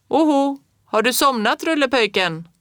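high, loud hollering tease; teasing call. A narrator's reading. Fritjof calls down the stove pipe, teasing and loud. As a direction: boom it down the pipe.

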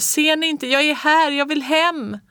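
high desperate calls, near tears; shouting. A narrator's reading. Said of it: "skrek jag".

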